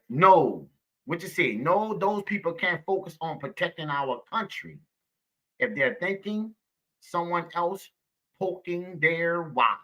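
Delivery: ordinary talk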